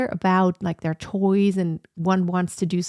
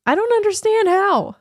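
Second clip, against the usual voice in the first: incredulous; 12 semitones higher, 6 dB louder than usual. Real, not acted. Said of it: "I don't understand how someone".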